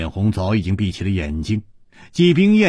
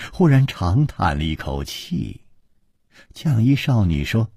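ordinary delivery, nothing marked

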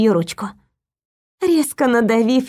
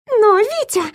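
nurse's voice; 10 semitones higher than usual. A narrator's reading. Nurse protests to Viktor.

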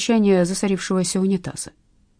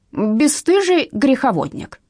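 slow, raised indignant shout; fervent outcry; 6 semitones higher, slower than usual. A narrator's reading. Mother denouncing the lover, slow raised outcry. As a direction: lash out at the sinner. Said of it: "с новым пылом".

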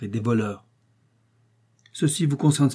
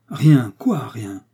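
loud, rising incredulous questioning, then dropping away; baffled echo; 6 dB louder, much slower than usual. A narrator's reading. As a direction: question the answer in disbelief. American echoes the answer, baffled. (répétition incrédule et interloquée)

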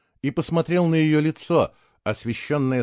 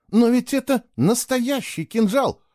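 higher, slow and stubborn; stubborn insistence; 12 semitones higher, 3 dB louder than usual. A narrator's reading. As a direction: dig in your heels, slowly. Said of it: "упрямо заявила она".

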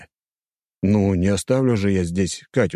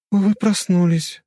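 high, slow, guilt-tinged female voice; guilty; 12 semitones higher, 3 dB louder, slower than usual. A narrator's reading. Woman greets the waking man, sounding guilty. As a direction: say it slowly, as if at fault. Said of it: "с виной в голосе".

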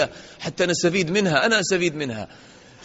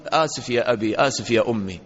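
lower, meek sorry to parents; humble apology; 6 semitones lower than usual. Shown as a demonstration. Son humbly apologising to his parents, lower and meek. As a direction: say sorry with head bowed. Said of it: "لا، قل".

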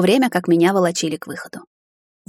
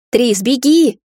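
higher, louder urgent cry; raised-voice command; urgent demand; 5 semitones higher, 5 dB louder, much slower than usual. A narrator's reading. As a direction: call out to make them flee. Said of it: "потребовала свекровь".

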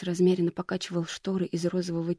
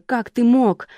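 higher, louder furious accusation; angry outburst; 5 semitones higher, 6 dB louder, slower than usual. A narrator's reading. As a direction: hurl it at him. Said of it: "накинулась она на Ника".

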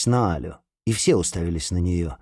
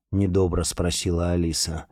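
ordinary delivery

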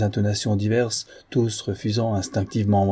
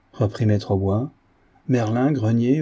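plain reading voice

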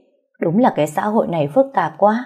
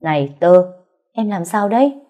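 ordinary delivery

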